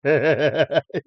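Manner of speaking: loud, high rolling laugh; hearty laugh. Real, not acted. Cracking up at the comedian's points, loud laugh. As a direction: crack up loudly.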